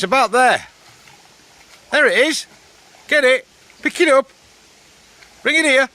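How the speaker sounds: high, loud coaxing commands; calling out to dog. Real, not acted. Man urging his dog to fetch the rock, high loud calls.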